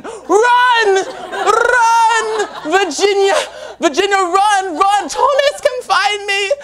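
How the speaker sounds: high, loud hammed-up British cries of panic; bad British accent. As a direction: shriek like a fleeing Victorian lady, laughing. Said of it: "as bad as my accent".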